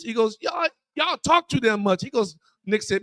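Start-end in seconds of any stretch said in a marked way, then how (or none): 0.4-2.0 higher-pitched retold scolding; a hint of an exasperated coach; mock irritated coach. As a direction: toss it off like an annoyed coach. Coach arguing with player.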